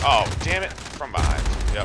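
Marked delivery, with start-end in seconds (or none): 0.1-0.7 angry outburst. Real, not acted. Getting killed in a firefight.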